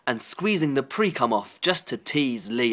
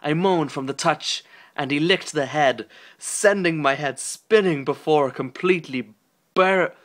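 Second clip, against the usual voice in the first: plain reading voice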